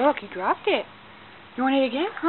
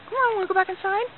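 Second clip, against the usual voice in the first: ordinary talk, nothing marked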